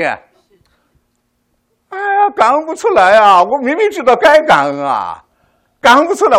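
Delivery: high, loud wail of frustration; anguished cry. Real, not acted. Preacher pleading for sympathy with a loud anguished cry. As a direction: cry out that you cannot give thanks. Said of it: "你们能不能同情我".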